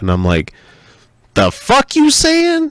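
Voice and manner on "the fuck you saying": high, loud, slow disbelieving outburst; outraged. Retold or imitated. Dad reacting to mother-in-law's remark, outraged disbelief. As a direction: blurt it in shocked outrage.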